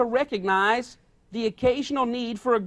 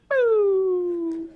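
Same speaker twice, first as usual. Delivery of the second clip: mock scare boo; 8 semitones higher than usual. Someone sets you off.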